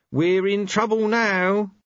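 worried angel. Acted. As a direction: let the bad news sink in. Angel realizing danger.

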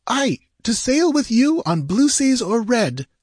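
high, fervent heroine; passionate. A narrator's reading. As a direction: answer with ardent devotion. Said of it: "she answered passionately".

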